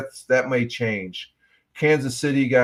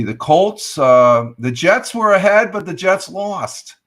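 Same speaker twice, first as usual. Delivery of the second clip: higher, louder build through the score; excited recap; 7 semitones higher, 8 dB louder, slower than usual. Real, not acted. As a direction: tell it like a dramatic swing.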